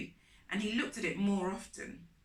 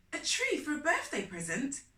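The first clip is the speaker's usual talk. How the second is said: surprised question; 6 semitones higher, 3 dB louder than usual. A narrator's reading. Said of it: "I said".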